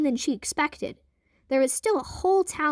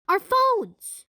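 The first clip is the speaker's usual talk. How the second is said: high, loud burst of discovery; excited realization; 8 semitones higher, 4 dB louder than usual. Acted. Twins realizing their phones can record, excited shout. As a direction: blurt it out, thrilled.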